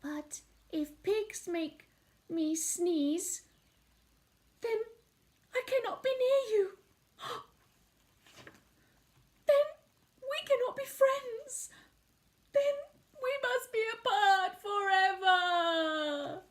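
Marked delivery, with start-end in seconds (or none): 4.6-16.4 dramatic sadness. Acted. Gerald's dramatic farewell.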